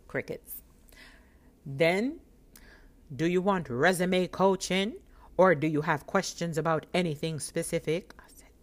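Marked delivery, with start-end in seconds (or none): 3.1-8.1 loud, businesslike official; serious clerk. Retold or imitated. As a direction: imitate a no-nonsense clerk, loudly. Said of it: "And she was so serious".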